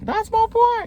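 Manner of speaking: sudden high shout of pride. Retold or imitated. Cheering for friend at his graduation, proud shout. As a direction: yell it out for your friend. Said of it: "Started shouting".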